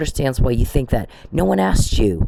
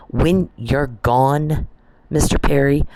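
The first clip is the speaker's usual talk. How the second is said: ordinary talk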